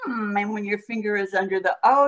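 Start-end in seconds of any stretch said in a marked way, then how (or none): 0.1-0.4 Mm hummed as the M sound. Shown as a demonstration. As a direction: hum the M sound. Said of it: "your mouth is going".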